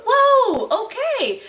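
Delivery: retold delighted shock; surprised whoa. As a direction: react as if the number floored you. Hearing the big order total.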